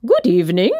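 lightly voiced silky monster; a touch of smooth, silky politeness; high silky monster. A narrator's reading. Second monster greeting the kid, lightly silky. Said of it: "he said in a high, silky voice".

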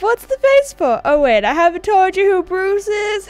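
high, loud hammed-up greeting; exaggerated surprise at a long face; chummy game character. Character asking about the player's face, high hammed-up dude.

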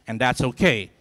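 ordinary talk, nothing marked

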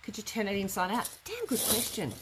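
ordinary talk

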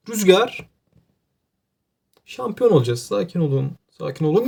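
ordinary delivery

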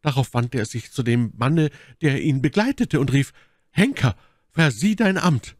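hohe, eindringliche Frauenrolle; Milady, anklagend. Acted. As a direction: dramatisch in hoher Stimme vom Henkersbefehl erzählen. (hohe, anklagende Stimme der Milady)

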